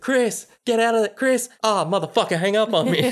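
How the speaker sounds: high-pitched, exasperated phone plea; mock frantic calling. Retelling a friend cut off on the phone, mock frantic.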